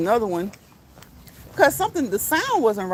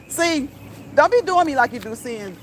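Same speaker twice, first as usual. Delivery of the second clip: usual speaking voice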